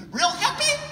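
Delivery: mock the idea in a high chirp; sarcastic mock. Speaker mocking the audience's expectation, high sarcastic lilt. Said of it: "how do you expect our behavior to be".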